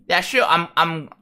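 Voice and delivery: usual speaking voice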